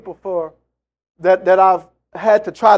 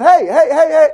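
louder, higher repeated hey; calling out; 8 semitones higher, 7 dB louder than usual. Retold or imitated. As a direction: raise the voice to get an answer. Calling out over phone.

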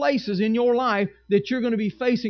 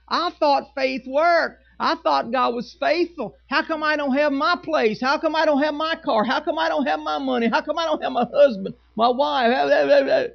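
mock complaining; 4 semitones higher than usual. Pastor imitating complaining believers, mock whine. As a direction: list the grievances like a sulking believer. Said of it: "they start harassing Moses".

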